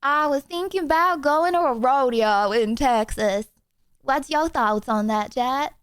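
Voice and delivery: hammed-up mock Texas twang; second stab at a Texas accent, laid on thicker; attempted Texas accent. Streamer asking chat about a rodeo, hammed-up Texas accent. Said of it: "That is not a Texas accent".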